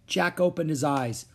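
plain reading voice

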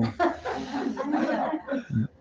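a sudden high burst of laughter. Real, not acted. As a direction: laugh at your own slip. Se ríe de su propio lapsus. (risa aguda y espontánea)

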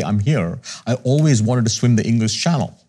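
usual speaking voice

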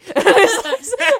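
high loud laugh bursting out; loud burst of laughter. Real, not acted. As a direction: crack up loudly. Laughing at own joke.

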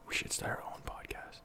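hushed whisper. Acted. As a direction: whisper it secretly. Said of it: "why are we whispering".

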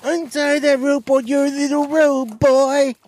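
high, slow put-on priest voice; high slow leering priest; creepy priest.

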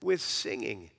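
solemn preacher's scripture read. Acted. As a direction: proclaim the psalm to the congregation. Preacher reads psalm aloud.

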